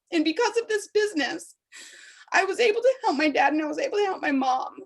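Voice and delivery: pushing through real emotion, high and strained; choked-up heartfelt plea; emotional. Real, not acted. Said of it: "I got a little emotional".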